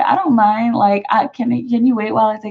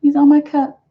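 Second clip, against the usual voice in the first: ordinary delivery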